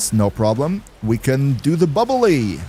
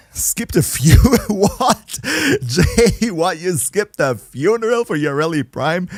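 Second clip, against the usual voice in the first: incredulous, higher, breaking into a laugh; amused disbelief; 6 semitones higher, slower than usual. Real, not acted. Streamer learns a viewer skipped a funeral to play, laughing disbelief. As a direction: react in high, laughing disbelief.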